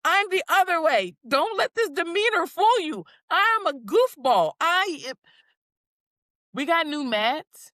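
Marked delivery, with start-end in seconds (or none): 0.1-5.2 playful boast. Retold or imitated. Voicing her playful self.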